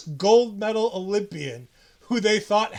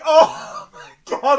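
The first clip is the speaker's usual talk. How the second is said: laughing disbelief; 7 semitones higher, 10 dB louder, much slower than usual. Retold or imitated. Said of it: "And I'm like".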